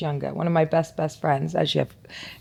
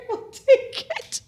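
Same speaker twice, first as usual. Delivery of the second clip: mock rich diner; much slower than usual. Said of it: "So they go".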